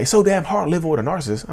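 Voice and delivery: high-pitched quote of an angry wife; wife's exasperated complaint. Retold or imitated. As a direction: imitate a fed-up partner's parting shot. Wife's parting shot, acted out.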